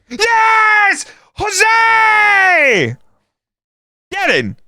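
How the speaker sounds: high, ecstatic scream of joy; goal celebration shout. Real, not acted. Fan screaming at his screen as his striker scores, ecstatic shout. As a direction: roar it like your team just scored.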